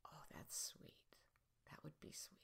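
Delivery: soft whispered thought to yourself; hushed inner voice. Retold or imitated. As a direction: whisper it as a private, tender reaction. Voices her quiet inner reaction.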